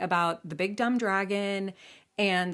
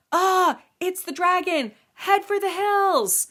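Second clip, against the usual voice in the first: high, loud frightened cries; hammed-up townsfolk fleeing; panicked crowd; 11 semitones higher, 6 dB louder, slower than usual. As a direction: shriek and scatter in terror. Townsfolk flee the dragon.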